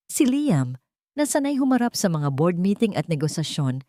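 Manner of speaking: ordinary delivery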